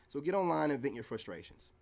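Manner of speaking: ordinary delivery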